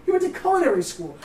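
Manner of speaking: high, emphatic disbelief; excited. Real, not acted. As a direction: sell the amazing news. Excitedly repeating surprising fact.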